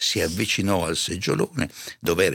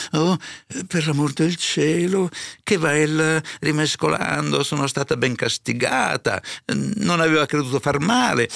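high, placating servant; submissive housekeeper; 8 semitones higher, 3 dB louder than usual. A narrator's reading. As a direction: soothe the master, pleading in a higher voice. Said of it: "ma in aria sommessa". (serva remissiva che supplica, voce acuta)

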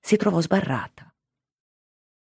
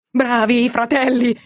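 shouting cheer; 6 semitones higher than usual. A narrator's reading. Prisoner cheering on his rescuers, high shout. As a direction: egg them on, shouting. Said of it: "gridava Fermo".

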